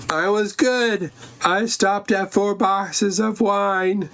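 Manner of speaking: high, drawn-out put-on voice; mock self-satisfied reply. [name] tells the golfing guy she was good, mock-proud.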